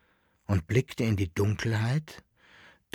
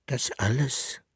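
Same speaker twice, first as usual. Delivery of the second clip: strange voice; 3 dB quieter than usual. A narrator's reading. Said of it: "mit einer fremden Stimme".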